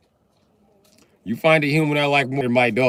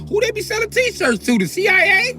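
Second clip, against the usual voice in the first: incredulous; 6 dB louder than usual. Acted.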